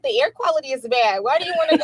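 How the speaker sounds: high, loud pleading objection; protesting mother. Retold or imitated. Mother retelling her objection to the trip, loud protest. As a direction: protest loudly, close to laughing. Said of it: "I said".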